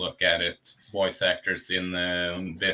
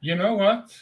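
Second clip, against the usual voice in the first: higher and louder, bursting with an idea; excited interruption; 10 semitones higher, 6 dB louder than usual. Real, not acted. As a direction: jump in, thrilled by a sudden idea. Sudden idea, cuts in eagerly.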